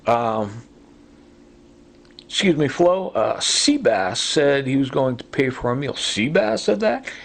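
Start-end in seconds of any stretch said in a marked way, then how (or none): 5.9-7.1 high, rising mock-incredulous question; pitched-up pretend surprise; mock disbelief. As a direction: feign shock that he said it. Mock-incredulous echo of a claim.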